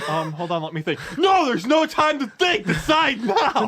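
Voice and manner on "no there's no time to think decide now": high, loud angry yelling; angry shouting. Pretend. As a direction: yell at the indecisive customer.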